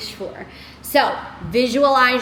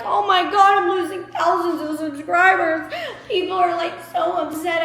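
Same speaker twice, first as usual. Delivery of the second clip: hammed-up creator panicking about lost subscribers; mock panic; 5 semitones higher than usual. Imitating other creators fretting over subscribers, mock panic. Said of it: "Most people would be like".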